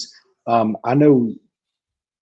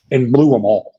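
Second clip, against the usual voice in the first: exasperated; 4 dB louder than usual. Real, not acted. Exasperated over the team.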